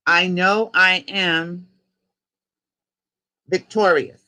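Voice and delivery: rising, declaiming call with long gaps for repeating; slow decree. Acted. Leader calling out a decree for the group to repeat, slow and rising. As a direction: lift each "free" word by word, slowly.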